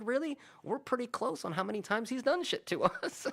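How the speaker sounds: high-pitched, breaking into laughter; high retold reply dissolving into laughs; laughing disbelief. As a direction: squeal it, amused and incredulous.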